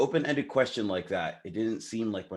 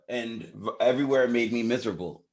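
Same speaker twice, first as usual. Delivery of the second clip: usual speaking voice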